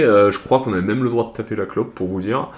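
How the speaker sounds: ordinary delivery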